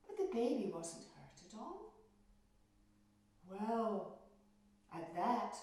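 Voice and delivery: ordinary delivery, nothing marked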